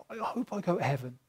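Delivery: quiet, doubtful mock wishing; tentative hope. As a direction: softly imitate an unsure hope. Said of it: "it's not like".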